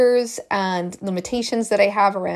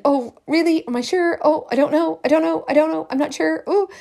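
higher, fretting voice of doubt; a worried client spiralling, pitched up; anxious self-doubt; 7 semitones higher than usual. Retold or imitated. Client second guessing herself, fretting higher.

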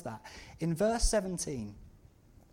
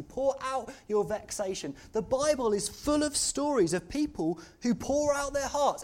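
higher, louder, driving sermon voice; preaching at full force; 6 semitones higher, 5 dB louder than usual. Acted. Preacher urging the congregation to pour out their hearts, at full force.

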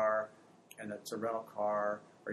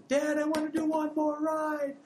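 put-on high, pleading kid voice begging for one more ride; whiny child; 6 dB louder than usual. Kid begging dad for one more ride, high whiny plea. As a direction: whine like a tired little kid.

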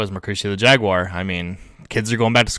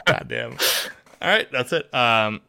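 ordinary talk, nothing marked

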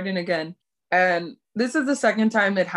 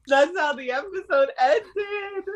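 high, whimpering near-cry; tearful; 8 semitones higher than usual. Real, not acted. Co-host closing the sad episode recap, tearful. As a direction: squeeze it out as if about to cry.

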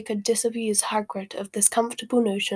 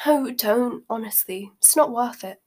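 plain reading voice

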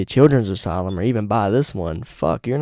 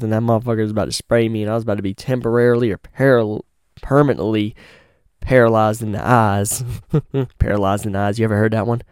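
ordinary delivery

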